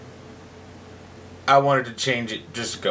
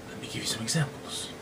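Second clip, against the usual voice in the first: a sly, breathless aside; stage whisper; 3 semitones lower, 10 dB quieter than usual. Acted. Cyrano turns to the heckler, whispering slyly. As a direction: hush it like a secret threat.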